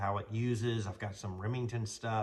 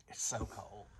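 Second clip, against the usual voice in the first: a real whisper against the cold; cold whisper to yourself; 4 semitones lower, 8 dB quieter than usual. Real, not acted. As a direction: breathe it out under your breath. Feeling the cold outside.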